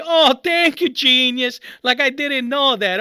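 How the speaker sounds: heavily ironic thank-you; sarcastic thanks. Retold or imitated. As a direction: pour scorn on obvious advice. Mock thanks to obvious advice.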